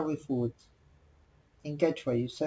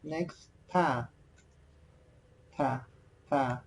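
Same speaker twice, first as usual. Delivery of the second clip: ordinary talk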